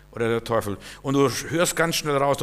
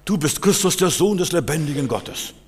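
Peter proclaiming Christ, raised and bright; Peter's confession; 5 semitones higher, 4 dB louder than usual. Retold or imitated. Preacher quoting Peter to the congregation, raised voice. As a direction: proclaim it with awe. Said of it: "der sagt".

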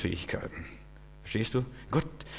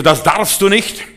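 high, loud and slow, laying down the law; stern prohibition; 8 semitones higher, 9 dB louder than usual. Acted. Preacher voicing the law to the congregation, high and loud. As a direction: forbid it like a strict lawgiver.

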